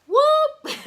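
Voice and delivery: high, loud whoop that breaks into a laugh; a sudden delighted yelp; sharp high whoop with a laugh; excited whoop. Retold or imitated. Antenna goes up, excited whoop.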